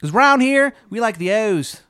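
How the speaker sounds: loud, high, exaggerated Maryland twang; terrible Maryland accent. Baba Yaga greeting a newcomer, bad Maryland accent. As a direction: holler in a hammed-up Maryland accent. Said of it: "She's got the shittiest Maryland accent".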